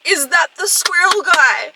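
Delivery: high, loud and slow alarmed question; rising panic, stretch it out. Real, not acted. Repeats dread question three times.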